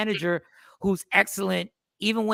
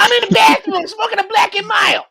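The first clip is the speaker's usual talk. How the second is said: from another room; 12 semitones higher, 9 dB louder than usual. Real, not acted. Answering from the bathroom.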